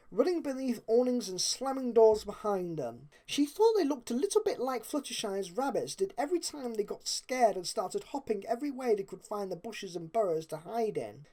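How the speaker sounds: plain reading voice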